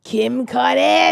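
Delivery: put-on slow, drawn-out Boston accent. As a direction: stretch the name in a thick Boston accent. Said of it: "really harsh accent".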